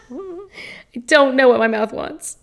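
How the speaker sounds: giggling through the words; laughing while repeating it. Real, not acted.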